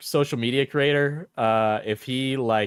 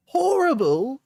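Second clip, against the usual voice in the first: HORRIBLE very high in mock Cockney; attempted Cockney; 13 semitones higher, 3 dB louder, slower than usual. Streamer voicing the offended partner's comeback, mock Cockney. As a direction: throw "Horrible" back in a hammed-up high Cockney. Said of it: "It's Cockney, I think".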